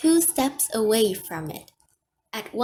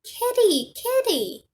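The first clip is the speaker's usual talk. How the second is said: high-pitched, excited little squeal of words; excitable toddler; 11 semitones higher, 5 dB louder than usual. A narrator's reading. Three-year-old grabs for the cat's tail, excitable. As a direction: call the cat like a thrilled small child. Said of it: "with an excitable".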